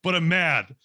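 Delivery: a sulky little devil insisting, a touch loud; the shoulder devil, stubbornly mad. Retold or imitated. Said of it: "It's like".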